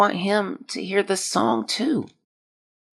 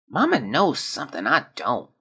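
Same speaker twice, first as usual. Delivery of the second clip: ordinary talk, nothing marked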